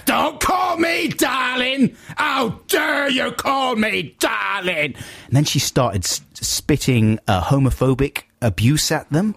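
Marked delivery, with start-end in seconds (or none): 0.0-4.9 high, drawn-out outraged mock screech; hammed-up slow, high-pitched fury; aggressive matriarch. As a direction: mimic an offended matriarch, stretching every word. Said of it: "It was really very aggressive".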